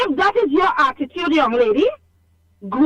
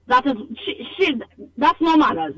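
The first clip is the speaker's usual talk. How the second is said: usual speaking voice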